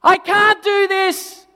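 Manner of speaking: high, slow, loud cry for help; child crying out at the end of herself; desperate scream. Retold or imitated. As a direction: scream it from the bedroom. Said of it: "I hear this scream come out of the bedroom".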